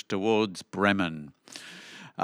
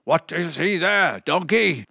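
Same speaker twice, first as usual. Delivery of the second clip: higher-pitched doggy voice; barking dog; 6 semitones higher than usual. A narrator's reading. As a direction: bark the question up to the donkey. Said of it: "looked up and barked".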